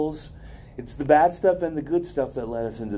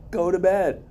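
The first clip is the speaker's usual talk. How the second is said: higher-pitched mock order to oneself; self-scolding; 6 semitones higher than usual. Speaker tells self off at the refrigerator, mock scolding. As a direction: order yourself off, playfully exasperated.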